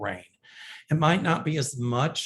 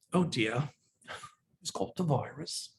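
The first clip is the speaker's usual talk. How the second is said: amateur Cockney, trailing off softer; Cockney accent; 6 dB quieter than usual. Shown as a demonstration. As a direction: put on a jokey Cockney accent. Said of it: "I love Cockney".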